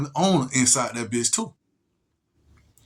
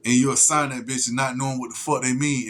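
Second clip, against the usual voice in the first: ordinary talk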